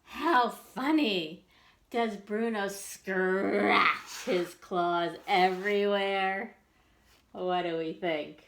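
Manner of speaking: ordinary delivery, nothing marked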